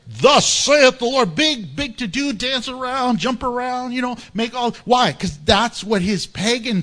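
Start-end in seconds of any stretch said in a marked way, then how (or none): 0.1-1.4 lightly voiced grand prophet, slow; a hint of a showy prophet proclaiming; mock prophet. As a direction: intone it like a pompous seer. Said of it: "He's like".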